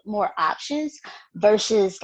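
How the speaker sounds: usual speaking voice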